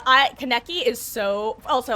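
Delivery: usual speaking voice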